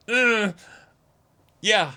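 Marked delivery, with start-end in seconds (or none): 0.1-0.6 high, loud mock groan of frustration; frustrated groan. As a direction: groan like you're stuck on the lyrics. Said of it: "So it's like".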